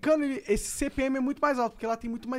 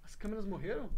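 softly asking, almost under breath; barely-there worried question; quietly; 3 semitones lower, 10 dB quieter than usual. Real, not acted. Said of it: "ele falou baixinho".

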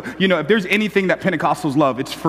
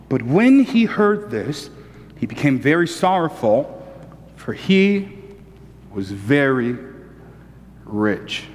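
slow, measured Bible reading, the last word sinking low; scripture reading; 7 semitones lower, slower than usual. Acted. Pastor reads the verse to the congregation, slow and measured. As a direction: read the verse slowly and deliberately.

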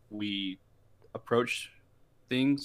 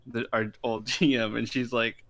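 ordinary delivery

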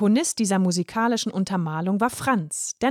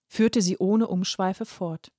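plain reading voice

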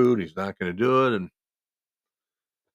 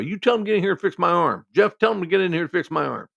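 yelling demand; 9 semitones higher, 6 dB louder than usual. Retold or imitated.

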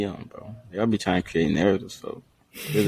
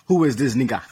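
badly faked Russian accent, mistaken for African; botched Russian accent; 4 semitones higher than usual. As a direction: attempt a Russian voice and land somewhere African, laughing. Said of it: "Is that African, uh, accent?".

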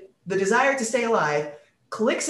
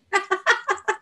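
high bursting laugh; 13 semitones higher than usual. Real, not acted. Laughing after story.

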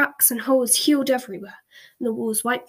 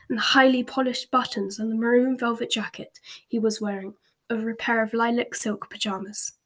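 plain reading voice

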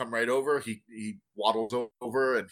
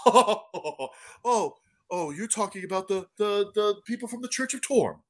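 a hint of an amused barkeep, higher; lightly voiced chuckling bartender; 7 semitones higher than usual. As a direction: chuckle it out as the friendly barman.

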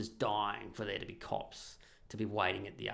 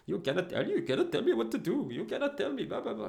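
high-pitched imitation of a driver's angry rant; mock a man losing it, voice pitched way up; hammed-up high, indignant tirade; losing his shit; 9 semitones higher than usual. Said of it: "he loses his shit at me".

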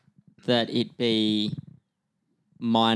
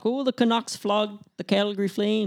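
put-on Canadian accent; 9 semitones higher than usual. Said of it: "it sounds weird in a genuine Canadian accent".

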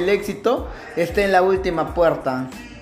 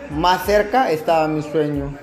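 booming motivational speech; 5 semitones higher, 7 dB louder than usual. Acted.

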